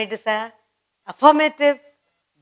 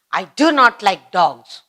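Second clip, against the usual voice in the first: slow and emphatic, stress on "do"; firm statement; 3 semitones lower, 5 dB louder, slower than usual. Shown as a demonstration. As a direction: plant "I do not like dogs" firmly, leaning on "do". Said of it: "You're affirming".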